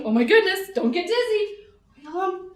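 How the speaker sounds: ordinary talk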